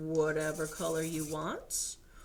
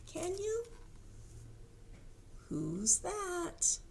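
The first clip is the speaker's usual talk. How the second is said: ordinary delivery, nothing marked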